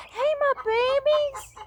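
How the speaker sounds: high, slow drawn-out coo; cooing greeting. Real, not acted. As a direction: sing out to the chicks. Greeting chicks.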